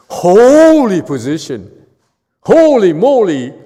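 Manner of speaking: loud, slow, ringing repetition; booming ridicule of the holy title; loud mocking emphasis. Real, not acted. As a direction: belt out the mocking phrase. Mocking the coveted holy position.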